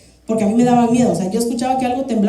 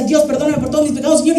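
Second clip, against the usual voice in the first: fast, higher rushed plea to God; hurried prayer; 5 semitones higher, faster than usual. Retold or imitated. Frightened girl praying to God during a quake, rushed plea. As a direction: gabble the confession before the end. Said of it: "Yo decía".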